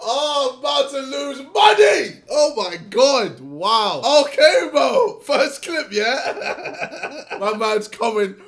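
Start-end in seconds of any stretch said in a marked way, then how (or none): 0.5-7.2 shouting through loud laughter; high, loud laugh, then yell it. Real, not acted. Bursting out laughing at the clip.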